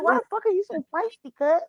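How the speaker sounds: high-pitched mock exasperation; exasperated retort. Retelling a scolding of the feisty cat, exasperated. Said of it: "And I was like".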